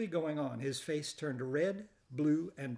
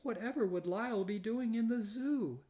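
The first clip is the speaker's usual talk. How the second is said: miserable protest; 8 semitones higher than usual. Acted. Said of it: "Missus Prim exclaimed miserably".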